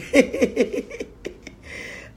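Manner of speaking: high wheezing laughing fit; real breathless-sounding laughter; uncontrollable laugh. Real, not acted. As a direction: laugh helplessly, out of control. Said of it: "I just can't hold him".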